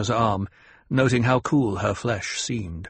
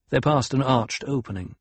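plain reading voice

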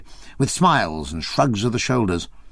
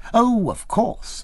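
slow, much higher, stretched-out assent; drawn-out agreement; 12 semitones higher, much slower than usual. A narrator's reading. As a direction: draw out the reassurance with a rising sweep.

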